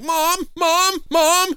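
very high, stretched mock-terrified calls; terrified cry for mom. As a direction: wail for mom in hammed-up panic. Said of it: "and he's like".

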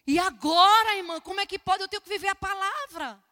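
loud, high outburst of dismay; agitated exclamation. Retold or imitated. Preacher retelling her dilemma to the congregation, agitated. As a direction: cry it out, pitch and volume up.

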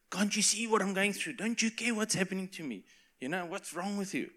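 high-pitched whiny complaint to God; imitated indignant prayer, pitched high; mock exasperated pleading. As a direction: plead as if nobody is listening, hammed up. Own past complaint to God acted out.

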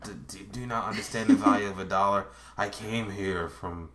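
imitation disappointed immigrant father; stern immigrant dad. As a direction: lecture word by word as a strict dad. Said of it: "Your dad's like".

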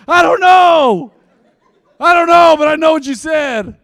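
high, very loud whiny outburst, played for laughs; mock exasperated yell. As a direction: yell back like a cornered kid. Acted kid yelling back at parents.

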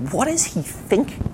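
high, softened disbelief; acted incredulity. Onlooker reacts to Peter's rash request, mock incredulous. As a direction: question it in a high, baffled voice.

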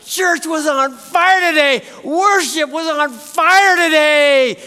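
slow, high, loud hammed-up Nicholson; Jack Nicholson voice. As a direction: really ham the Nicholson drawl. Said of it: "Just like that".

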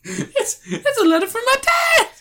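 very high, laughing squeal; excited kid rambling. Retold or imitated. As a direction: gush it like a giddy child. Voicing thrilled Luke.

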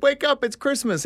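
high, excited wake-up call; imitated uncle's yell. Uncle waking the family on Christmas morning, imitated yelling. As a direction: pitch it high, rousing the house. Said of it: "he's yelling out loud".

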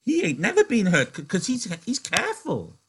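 a simple giant insisting his friend is safe; Lenny voice. Acted. Reader voicing Lenny defending George.

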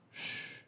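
soft, voiceless shush; hushing shh. Acted. God silencing someone who wants control, hushing. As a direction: hush them gently.

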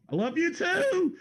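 high, laughing call; high-pitched holler breaking into laughter; called-out affection. Real, not acted. Calling back to someone off mic.

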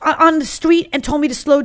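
keen to confront him, lifted voice; eager question. Retold or imitated. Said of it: "I said".

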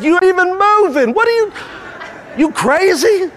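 lightly voiced Jesus, high and teasing with a laugh; Jesus voiced, amused. Jesus teasing the believer who sits parked, high and amused. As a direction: poke fun at the parked driver. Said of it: "He's like".

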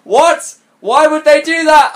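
high, loud outraged questions; almost screamed. A narrator's reading. Heroine hearing he was invited, near-scream. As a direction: cry out just short of a scream. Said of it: "I almost screamed".

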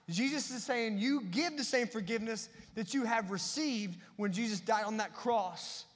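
ordinary delivery

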